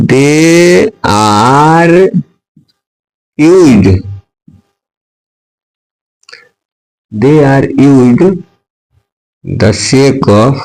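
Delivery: drawn-out word by word dictation; slow dictation. Shown as a demonstration. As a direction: stretch every word so the class can copy it. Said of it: "teacher dictating the definition". (धीमी श्रुतलेख शैली)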